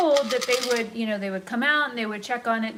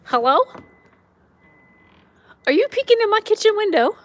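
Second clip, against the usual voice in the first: much higher and louder, sing-song; pet talk; 12 semitones higher, 11 dB louder than usual. Real, not acted. Woman teasing the cat at her kitchen window, high loud pet talk.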